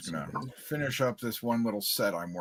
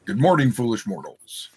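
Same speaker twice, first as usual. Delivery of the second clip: booming spooky host greeting; dark lord; 8 dB louder than usual. Acted. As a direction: welcome the foolish mortals grandly. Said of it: "He is the Ghost Walker".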